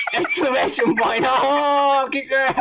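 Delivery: laughing through the words; breaking into real laughter; cracking up. Real, not acted. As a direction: lose it while reading aloud. Breaks up laughing while reading.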